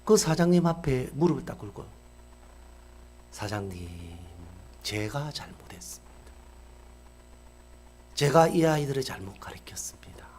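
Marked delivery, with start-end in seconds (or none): 3.3-10.3 humble plea. Retold or imitated. Principal kneels begging forgiveness.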